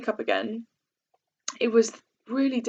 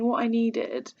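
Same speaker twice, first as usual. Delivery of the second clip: ordinary talk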